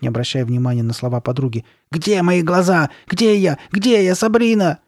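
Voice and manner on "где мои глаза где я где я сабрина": high, loud, panicked questions; hysterical cry. A narrator's reading. Carly Beth lost in the mirror, hysterical.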